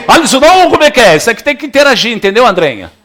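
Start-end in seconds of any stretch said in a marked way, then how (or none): 0.1-1.2 louder, higher mock protest; mock churchgoer cry. Preacher mimicking a member interrupting him, higher and louder. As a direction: play a startled churchgoer.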